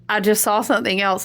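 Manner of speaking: normal talking voice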